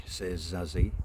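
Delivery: ordinary delivery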